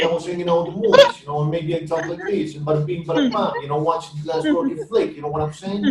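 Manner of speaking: playful New York tough-guy impression; light New York accent, bada bing style; mock New York accent. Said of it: "talk like I'm from New York".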